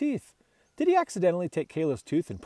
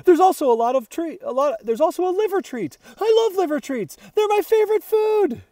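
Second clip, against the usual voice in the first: much higher and louder, gushing with delight; excited dog; 12 semitones higher, 8 dB louder than usual. A narrator's reading. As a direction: squeal it like a dog who just found treats. Dog thrilled by liver treats.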